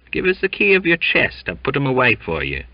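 high, quick eager Cockney; eager wheedling sailor; friendly eagerness. Acted. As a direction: wheedle him to get the key. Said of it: "with friendly eagerness".

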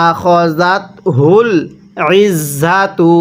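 slow, drawn-out tajweed chant; Quran recitation. Shown as a demonstration. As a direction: recite it slowly, stretching the vowels. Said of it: "কিভাবে পড়ব?". (ধীরে টেনে কুরআন তিলাওয়াত)

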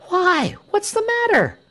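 very high, urgent question; agitated crowd. A narrator's reading. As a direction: press for the news in alarm. Crowd presses for the news.

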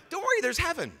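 high, breezy brush-off; dismissive God. Pretend. Preacher mocking an uncaring God, high and breezy. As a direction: wave the pain away glibly. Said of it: "God has no compassion".

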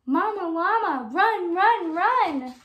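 excited storybook shout; urgent call. Acted. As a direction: call out run run run like cheering her on. Urging mama llama to hurry.

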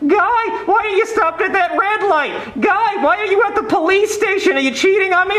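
very high-pitched suspicious wife; nagging wife. Retold or imitated. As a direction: mimic an accusing wife in a piercing high voice. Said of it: "She's like".

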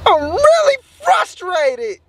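high, slow frustrated whine. Real, not acted. Vlogger venting that he's really mad, frustrated whine. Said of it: "I'm really frustrated and I'm really mad".

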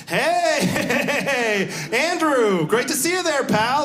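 high, overly bright Hey, hey, Andrew greeting, voice lifted well up; suspiciously nice coworkers. Retold or imitated. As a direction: play the too-friendly coworkers greeting Andrew, syrupy and eager. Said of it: "It's like".

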